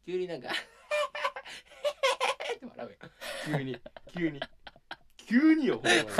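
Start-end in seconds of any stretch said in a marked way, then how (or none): 0.0-2.5 a sudden burst of high laughter; mock sudden laugh. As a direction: burst into a shrill laugh out of nowhere. Imitating his sudden laugh. (急に笑い出すまね)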